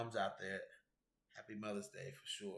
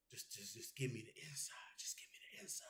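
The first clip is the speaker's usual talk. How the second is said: whisper; 12 dB quieter than usual. Retold or imitated. Begging Tatiana for inside info.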